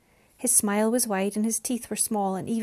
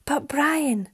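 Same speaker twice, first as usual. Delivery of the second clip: higher, imploring protest; pleading; 6 semitones higher, 4 dB louder than usual. A narrator's reading. Mariana protests to the angry father, pleading. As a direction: beg him to listen. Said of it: "Mariana pleaded".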